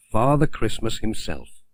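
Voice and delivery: plain reading voice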